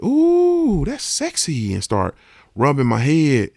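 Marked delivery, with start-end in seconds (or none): 0.0-1.7 aunt's flirty coo. Retold or imitated. Nephew imitating his aunt cooing over his shaved head, flirty high coo. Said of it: "my aunt came up to me and said".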